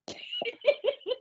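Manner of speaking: a quick squeaky giggle; high giggle. Real, not acted. Reader giggling at what the co-host missed, squeaky.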